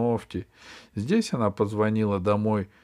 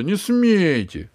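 shouting; 8 semitones higher, 5 dB louder, slower than usual. Acted. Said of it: "закричала Алиса".

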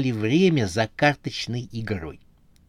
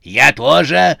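loud, higher interjection; combative outburst; 4 semitones higher, 9 dB louder, slower than usual. A narrator's reading. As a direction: jump into the quarrel, ready to fight. Witch rolls sleeves for fight.

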